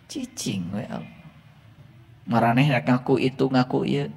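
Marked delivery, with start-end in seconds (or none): 0.1-1.2 very soft hush to God; hushed plea. Pretend. Mock worshipper quietly shushing God during prayer. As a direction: hush it under the breath.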